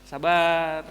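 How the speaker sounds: ordinary talk, nothing marked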